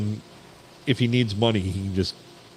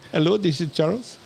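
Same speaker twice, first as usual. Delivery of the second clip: put-on high, sing-song Indian accent; mock call-center Indian accent; 8 semitones higher than usual. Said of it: "in an Indian accent".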